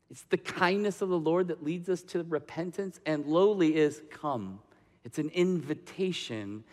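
hushed, tender preaching; gentle. Real, not acted. Preacher telling congregation of the Lord's kindness, gently. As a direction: drop to a soft, reassuring voice.

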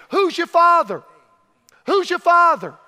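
loud, hammering question; preacher's loud challenge. Acted. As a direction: demand it of the crowd.

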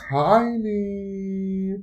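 tiny squeaked out high and loud; high, punchy comic stress; mock-shocked emphasis. Real, not acted. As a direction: gasp at how small they were. Mocking her own tiny shorts.

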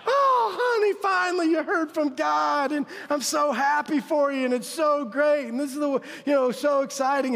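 high, loud, gushing wife voice; hammed-up overjoyed wife; imagined gushing wife. Pastor mimicking the gushing wife he imagined, overjoyed. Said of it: "she's gonna be like".

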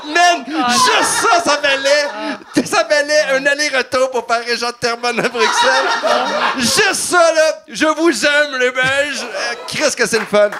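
high, loud, laughing shout to the crowd; declaring love to the Belgian crowd, shouting and laughing; excited shouting. Real, not acted. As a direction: yell it out, thrilled and cracking up.

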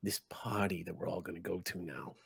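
low, hushed goblin voice; confiding goblin. Acted. Goblin leans over to ask Gator about the party, low and confiding. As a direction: lean in and ask softly, keeping it low.

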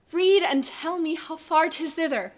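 slow, high theatrical line; Shakespearean delivery. Acted. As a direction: say it like a stage line.

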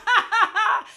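high, loud burst of laughter; loud laughing fit. Real, not acted. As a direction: lose it mid-story. Cracking up over own story.

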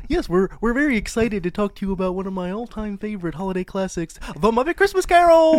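high, fast Kermit impression; bright frog puppet voice, excited. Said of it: "get into my Kermit the Frog voice here".

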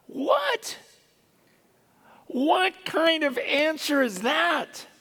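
very high, drawn-out bafflement; incredulous. Acted. Preacher reacting to Jesus's crazy answer, incredulous. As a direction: squeak the first word, then stretch out the disbelief.

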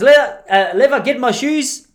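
put-on high, loud European-accented demand; European-accented grandfather. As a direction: call out slowly in a mock European accent. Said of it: "Not in that accent. He's Australian".

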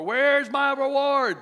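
high, loud, drawn-out mock whine; demanding shopper. Imitating people in stores asking for rewards, slow mock whine. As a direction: stretch out a mimicked entitled demand. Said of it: "I've heard it before in stores".

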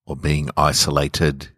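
mild, melancholy audiobook narration; story narration. A narrator's reading. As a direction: read it like a lonely late-night confession.

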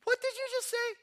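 very high-pitched, incredulous question; hammed-up high voice of affront; offended disbelief. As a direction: squeak it out in mock outrage.

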